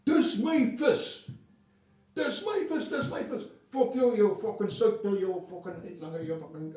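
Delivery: mock the Boer boy yelling over his fish; angry Afrikaans kid. Boer kid screaming at the boy who stole his fish, Afrikaans accent. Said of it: "fuck, I remember this".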